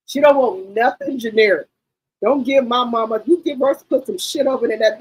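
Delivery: ordinary delivery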